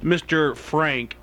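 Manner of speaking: slow grand announcement; announcer intro. Acted. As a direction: announce it like a showman.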